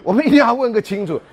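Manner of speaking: a teacher demanding an answer from the student, loud; calling out loudly. Retold or imitated. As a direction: shout after a student walking away.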